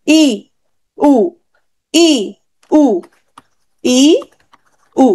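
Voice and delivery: ই and উ alternated, each sounded alone; high vowel demo. Shown as a demonstration. Teacher asks students to notice tongue height, demonstrating high vowels ই and উ. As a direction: switch between high vowels ই and উ with pauses. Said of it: "খেয়াল করো উচ্চ স্বরধ্বনি".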